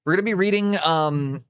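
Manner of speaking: ordinary talk, nothing marked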